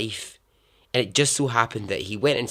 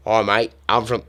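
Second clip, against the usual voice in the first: put-on accidental Australian accent; 2 semitones higher, 8 dB louder than usual. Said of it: "oh, that's Australian".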